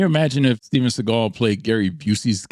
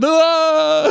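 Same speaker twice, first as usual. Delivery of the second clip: mock fight yell, higher and louder; mock battle cry; 13 semitones higher, 5 dB louder than usual.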